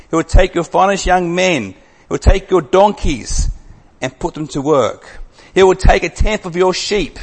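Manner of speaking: loud, high, emphatic scripture reading; preaching at full force. Acted. Preacher warning the congregation what a king will take, loud and emphatic. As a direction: thunder out each warning to the congregation.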